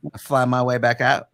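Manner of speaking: a hint of a gentle, chatty Bruce; Bruce from Family Guy. Veteran voices the bug chatting as it leaves, like Bruce. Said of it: "Why do you sound like Bruce from Family Guy".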